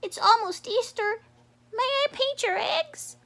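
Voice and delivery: high, eager little frog; Froggy's voice. Acted. Froggy asking Turtle to paint her eggs, high and eager. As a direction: ask sweetly as a hopeful frog.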